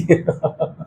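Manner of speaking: brief, soft chuckle; amused little laugh; short chuckle. Real, not acted. Laughs after the cut remark.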